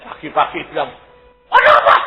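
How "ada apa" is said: high, loud yelped question; acted-out piercing shout; retold startled yell. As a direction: shout it as if jolted awake. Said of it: "teriaknya kan banter banget".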